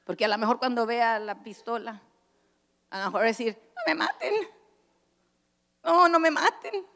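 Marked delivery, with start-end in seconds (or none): 3.7-6.9 a half-laughing pretend plea at gunpoint; mock pleading. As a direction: beg for your life, hammed-up and higher. Imagined plea facing a gun.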